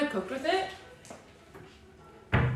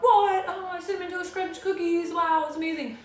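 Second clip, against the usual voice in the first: excited viewers; 8 semitones higher than usual. Pretend. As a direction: gasp with delight at the cookies. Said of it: "you guys are all like".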